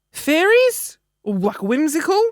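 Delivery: sing-song high "fairies", loud and airy; whimsical. Pretend. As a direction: say it like a dreamy fairy tale. Naming the whimsical theme.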